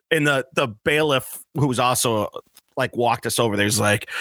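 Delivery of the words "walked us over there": ordinary delivery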